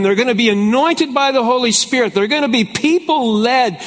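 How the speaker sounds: preaching scripture. Acted. Pastor preaching the outpouring to his congregation. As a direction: proclaim the promise with rising fire.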